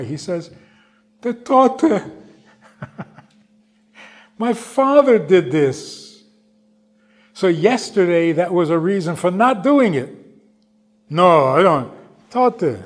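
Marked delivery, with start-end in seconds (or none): 1.2-12.8 high, loud, choked with emotion and a broken laugh; emotional old man near tears; tearful. Retold or imitated. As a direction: cry it out as the memory hits. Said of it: "he started to cry".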